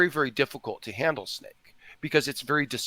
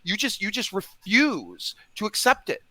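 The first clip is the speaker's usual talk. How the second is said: higher, louder exasperated lecturing; real frustration, pitched up; frustrated; 6 semitones higher, 5 dB louder than usual. Real, not acted. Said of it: "I'm getting frustrated".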